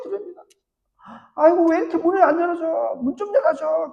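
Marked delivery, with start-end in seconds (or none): 1.4-3.9 higher, calling through the door to open up; impatient call from outside the gate; tired Peter pleading at the door. Acted.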